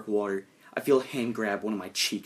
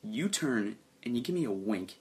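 usual speaking voice

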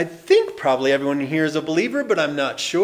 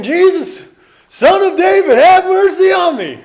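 much higher and louder beggar's cry; desperate yell; 14 semitones higher, 12 dB louder than usual. Retold or imitated. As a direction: shout for mercy across the crowd. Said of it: "He's yelling, yelling, yelling".

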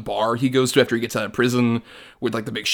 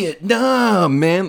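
higher, lazy hippie drawl, lightly voiced; mock stoned hippie; 7 semitones higher, 4 dB louder, much slower than usual. Podcaster mocking a movie's hippie woman, hammed-up hippie drawl. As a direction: play it like a spaced-out hippie. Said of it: "a hippie woman is in a bar talking about".

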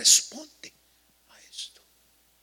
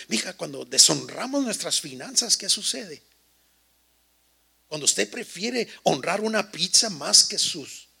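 ordinary talk, nothing marked

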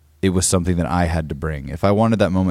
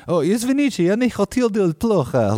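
pitched way up, anxious polite Russian; nervous apology in Russian. Retold or imitated. Apologizing to a drunk Russian stranger, high and nervous. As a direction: squeak out a flustered sorry in Russian.

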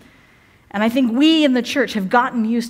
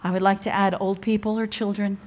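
ordinary delivery

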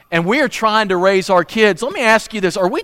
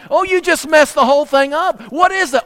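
much higher, half-joking dismay; mock dismay; 8 semitones higher than usual. Preacher acting a TV viewer, higher mock dismay. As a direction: act the viewer who thinks it's ruined. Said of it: "and you're like".

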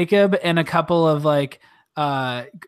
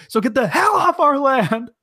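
high, loud, slow warning; finishing the tribe's warning with a slow, high, loud threat; mock threat; 10 semitones higher, 5 dB louder than usual. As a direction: draw out the threat loudly.

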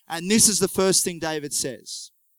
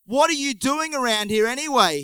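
a raised, mildly angry challenge; angry brother; 6 semitones higher, 3 dB louder than usual. A narrator's reading. Older brother Eliab scolding David, mildly angry. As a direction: snap at the little brother, pitched up. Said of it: "and he was angry".

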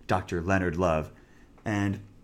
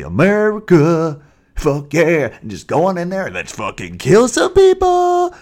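loud, far gung-ho war cry; patriotic shout; 8 semitones higher, 10 dB louder than usual. Acted. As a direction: bellow it like a jingoist.

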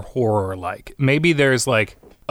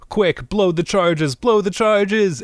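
higher, slow urgent shout; panicked order; 9 semitones higher, slower than usual. Acted. Rider shouting to comrades as the giant dragon wakes, much higher urgent shout. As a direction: yell for the charges to blow.

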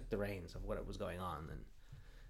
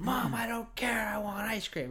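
high, whiny bratty demand; mock tantrum, pitched way up; spoiled kid yelling; 12 semitones higher, 4 dB louder than usual. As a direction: play the spoiled son demanding ice cream. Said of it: "yelling at his mother".

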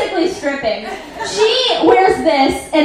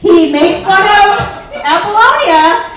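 ordinary talk

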